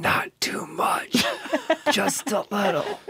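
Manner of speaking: slow high ghostly whisper; soft echoing dream voice. Pretend. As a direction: whisper it like a fading spirit.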